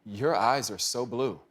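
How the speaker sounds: slow, low, smitten deadpan; imitating an awestruck man, unhurried; mock dreamy admiration. Miles's dreamy line to prince.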